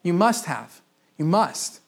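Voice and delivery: "must" pressed hard and falling; stress on MUST. Shown as a demonstration. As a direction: hammer the word "must". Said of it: "This isn't an option".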